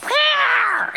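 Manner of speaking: a short very high, loud little roar; cub's angry roar. Retold or imitated. Lion cub angrily roaring back at the hyenas, tiny high roar. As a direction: roar with all you've got, but it comes out tiny. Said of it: "now he's getting angry, and so he goes".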